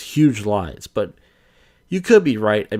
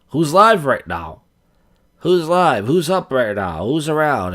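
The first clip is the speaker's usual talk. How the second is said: calling out; 5 semitones higher, 4 dB louder than usual. Retold or imitated. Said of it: "you just pop up and go".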